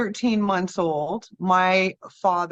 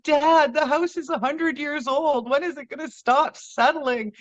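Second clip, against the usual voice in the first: put-on higher, exasperated child arguing with her dad; indignant kid; 8 semitones higher than usual. Girl in the old farmhouse answering her dad, higher and exasperated. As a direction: pitch it up like a kid who won't be fobbed off.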